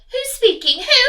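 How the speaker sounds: high, loud lady's voice; answering phone. Acted.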